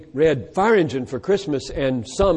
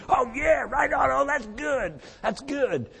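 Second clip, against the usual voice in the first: high gushing mock approval, half shouted; eager classmates; 10 semitones higher, 4 dB quieter than usual. Classmates cheer the neat argument, high mock enthusiasm. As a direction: ham up the naive students cheering the argument. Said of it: "my classmates saying".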